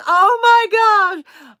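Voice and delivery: high, loud, drawn-out mock dismay; exaggerated exclamation. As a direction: stretch it out in exasperation. Acts out own exasperated reaction.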